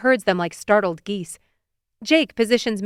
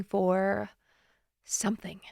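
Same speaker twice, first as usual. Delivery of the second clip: whispered; 8 dB quieter, slower than usual. A narrator's reading. Hoping, Lois looking up at Superman.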